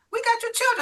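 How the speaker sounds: high, loud joyful announcement; excited news. Retold or imitated. Detective telling the mother her kids are found, thrilled. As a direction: burst out with the good news.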